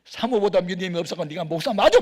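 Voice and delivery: the Spirit rebuking the pastor, loud and scornful; scolding Holy Spirit. Retold or imitated. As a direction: scold him like a stern voice from above. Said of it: "막 그런 것 같아, 성령님이".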